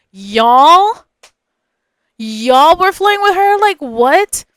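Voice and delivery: loud, rising disbelief; incredulous. Retold or imitated. Answering friend's flirting accusation, incredulous.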